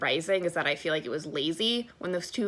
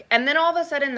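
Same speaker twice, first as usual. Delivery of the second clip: normal talking voice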